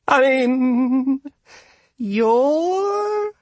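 very high, timid rabbit voice; nervous, shaking. A narrator's reading. Rabbit gulps and tries again, frightened squeak. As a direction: offer a scared second guess. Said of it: "then gulped, her entire body shaking".

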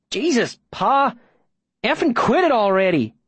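high-pitched exasperated protest; fed up, pitch jumping up on each plea; exasperated outburst. A narrator's reading. Davy begging his pa to quit it, exasperated.

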